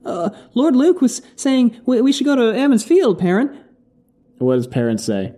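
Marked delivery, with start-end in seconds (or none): 0.1-3.6 high-pitched character voice; Kenley Ahan. Acted. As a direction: pitch it way up for a timid follower. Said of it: "Kenley Ahan goes".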